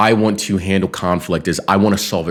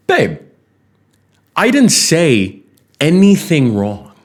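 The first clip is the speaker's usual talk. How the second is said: exasperated protest; 9 semitones higher, 4 dB louder, slower than usual. Retold or imitated. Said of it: "And I'm like".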